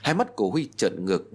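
plain reading voice

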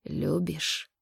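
growled. A narrator's reading. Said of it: "прорычал Кассандр".

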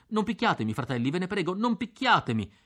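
high, pleading cry not to be hit; high panicked plea for mercy; frightened scream. A narrator's reading. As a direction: beg in panic, voice jumping up. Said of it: "Allora io scricciai".

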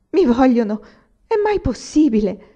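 can't believe his luck, voice climbing; stunned happy exclamation; disbelieving joy. Acted. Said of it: "ripeteva fra sé Anania".

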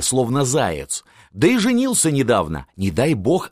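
plain reading voice

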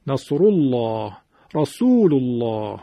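slow "نصر الله، رسول الله" with thick, dark L and back a; big, heavy lam. Shown as a demonstration. Teacher shows how big it sounds, heavy lam. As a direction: draw out each "الله" full and thick.

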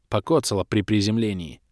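ordinary delivery

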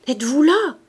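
loud, high questioning shout; crying out. A narrator's reading. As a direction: shout it down into the coffin. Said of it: "et cria".

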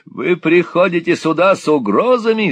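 put-on high, loud gloating old miser; vile sneer. A narrator's reading. Old Gride threatening young Nicholas, sneering high. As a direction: threaten with a nasty grin. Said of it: "сказал Грайд".

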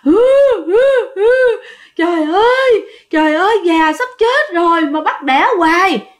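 loud high sobbing, then a wailing plea; wailing sobs. Retold or imitated. The sow lies crying and howling about being bred. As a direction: sob loudly and wail. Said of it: "nó vừa khóc mà nó vừa gào".

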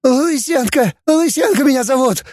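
very high squealing confession; pained screech. A narrator's reading. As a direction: squeal your name out under torture. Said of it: "проверещал Фанас".

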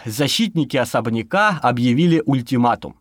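usual speaking voice

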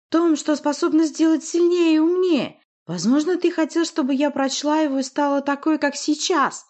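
high, loud possessed witch; piercing screech. A narrator's reading. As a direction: screech the accusation shrilly. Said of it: "пронзительный и визжащий".

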